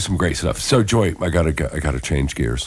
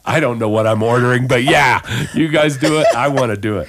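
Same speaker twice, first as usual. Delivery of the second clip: higher and louder, fast and eager; excited tourist; 8 semitones higher, 4 dB louder than usual. Retold or imitated. Host acts out her eager self ordering coffee in Australia, excited. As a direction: gush like a tourist ready to try anything. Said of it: "And I was like".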